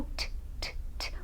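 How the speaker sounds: very soft voiceless T, repeated; isolated T sound. Shown as a demonstration. Teacher repeats the ending for learners, isolated T sound. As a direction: tap out a bare T with no vowel. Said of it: "sem a vogal".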